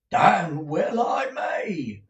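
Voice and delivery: slow, loud, high bluster; the manager roars his agreement; hearty retort. A narrator's reading. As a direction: bang it out proudly.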